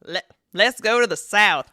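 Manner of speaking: loud, hammed-up Southern twang; weird Southern accent. To co-host, echoing him in a hammed-up Southern accent. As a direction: echo the line in an over-the-top Southern voice. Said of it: "this little accent that you just threw out".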